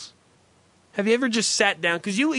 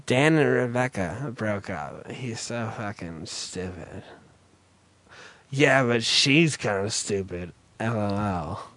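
put-on low, slow vapid teen voice; mock airheaded kid gossip; dumb teen gossip; 8 semitones lower, 3 dB quieter, slower than usual. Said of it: "And what do they do?".

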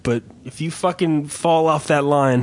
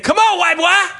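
high, loud taunt; taunting call; 13 semitones higher, 3 dB louder than usual. Retold or imitated. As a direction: goad him to come outside. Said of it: "And they said".

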